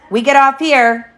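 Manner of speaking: commanding captain's cry. A narrator's reading. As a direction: call it out like a young captain. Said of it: "cried in his captain voice".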